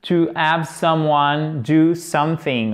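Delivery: slow, careful English demo; to have someone do something, said slowly; measured teacher reading; slow model phrase. Shown as a demonstration. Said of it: "native speaker".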